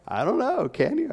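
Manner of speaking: high mock-teacher quip; mocking kindergarten teacher. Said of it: "That's what she would say".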